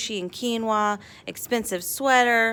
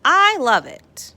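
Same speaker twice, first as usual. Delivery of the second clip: exclaimed; 6 semitones higher, 7 dB louder, slower than usual. A narrator's reading. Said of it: "Miss Emily exclaimed".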